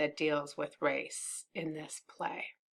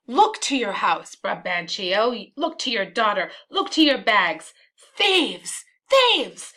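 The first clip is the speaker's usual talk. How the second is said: calling out; 2 semitones higher, 6 dB louder than usual. Acted. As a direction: call a warning up to the house. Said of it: "call out like the city is on fire".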